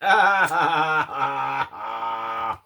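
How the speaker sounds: cracking up into tearful laughter; helpless laugh. Real, not acted. Owner cracks up at her parrot's antics. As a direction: laugh until it nearly turns to crying.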